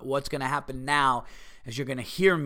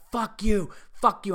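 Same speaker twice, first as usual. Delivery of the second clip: higher, louder pretend curse at a driver; mock angry fuck you; 7 semitones higher, 4 dB louder, slower than usual. Honking at a driver who blocked him, cursing him out. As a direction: spit it at the car while the horn blares. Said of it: "be like".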